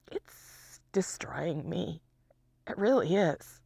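real exhausted whisper, slow; whispered despair. Real, not acted. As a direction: barely voice it, spent. Confesses being worn down by isolation.

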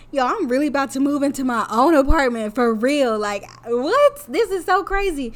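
blurting out her own excitement; excited self-quote. Retold or imitated. Recalling moving out, excited disbelief. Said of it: "And I'm like".

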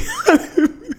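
breathless high giggle; can't stop giggling. Real, not acted. Laughing at own confession.

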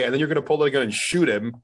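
ordinary delivery

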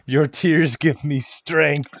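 slow mock villain.

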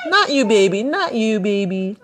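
laughing pet talk. Real, not acted. Talks sweetly to her pet.

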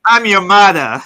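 hammed-up New York mom line, in a Brooklyn accent.

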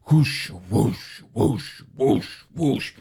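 imitated rhythmic churning whoosh; repeated airy whooshes, like something coming after you. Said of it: "this churning sound coming after me".

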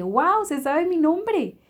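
high, loud, drawn-out wow; amazed. Retold or imitated. Retelling when the girl knew her name, amazed exclamation.

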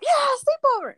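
put-on high, loud kid glee; excited kid. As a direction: squeal like a little kid. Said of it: "You're like".

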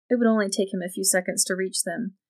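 ordinary delivery

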